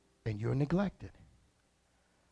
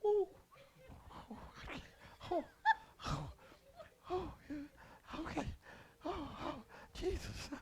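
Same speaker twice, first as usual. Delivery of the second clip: 1 mock startled shopper; 12 dB quieter than usual.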